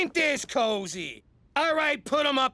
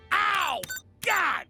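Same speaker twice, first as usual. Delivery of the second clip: pained yelp; 8 semitones higher than usual. Acted. Pain after cheer.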